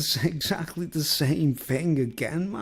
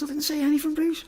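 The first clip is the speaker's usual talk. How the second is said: much higher, whiny mock protest; protesting friend; 13 semitones higher, faster than usual. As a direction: squeak out an innocent denial like the friend. Friend voiced denying again.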